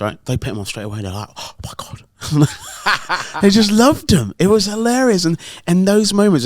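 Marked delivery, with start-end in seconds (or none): 1.3-2.0 breathless whisper of delight; whispered awe. Retold or imitated. Kids react to hats. 2.2-6.4 high, loud laughing delight. Real, not acted. Laughing recalling the kids.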